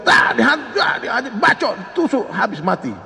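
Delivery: high, shouted burst of attack words; mock angry outburst. Preacher acting out the enraged killer, mock fury. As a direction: act out a hot-headed stabbing frenzy. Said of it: "emosi lagi".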